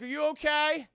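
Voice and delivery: worried asking. Retold or imitated. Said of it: "And Ned's like".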